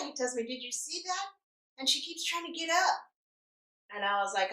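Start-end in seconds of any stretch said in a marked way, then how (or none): 0.4-3.1 imitating indignant nurse. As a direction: play a nurse grumbling about a patient. Retold agitated nurse complaining.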